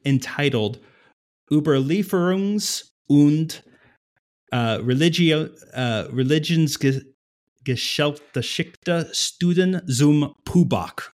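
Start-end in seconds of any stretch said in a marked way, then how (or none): 1.5-11.1 slow, careful attempt at German pronunciation; reading German. Shown as a demonstration. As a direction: sound out the German title in a halting English accent. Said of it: "I'm going to try to read it in German".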